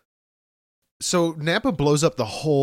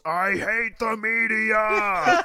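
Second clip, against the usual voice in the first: high, drawn-out hammy yell; mock villain yell; 9 semitones higher, much slower than usual. Podcaster imitating Nappa blasting the press, hammed-up yell. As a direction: yell it slowly at a high pitch. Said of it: "And he yells".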